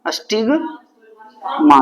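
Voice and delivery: Stigma drawn out very slowly; slow emphasis. Shown as a demonstration. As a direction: stretch stigma for the class to repeat. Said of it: "क्या बोलते हैं?".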